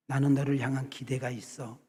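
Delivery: soft, tender words to the onion; gentle loving words. Retold or imitated. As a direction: coax it gently with love. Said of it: "이렇게 좋은 말, 듣기 좋은 말". (양파에게 다정하게 속삭이듯)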